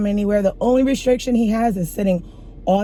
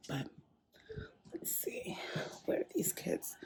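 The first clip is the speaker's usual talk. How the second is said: whispering; 9 semitones higher, 21 dB quieter than usual. Real, not acted. Whispering looking for kids.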